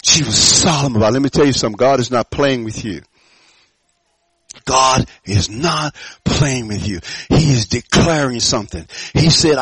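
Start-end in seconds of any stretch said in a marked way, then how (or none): 4.7-7.0 slow, weighty delivery; emphatic warning. Real, not acted. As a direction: space the words out slowly and deliberately. Repeats the warning slowly for weight.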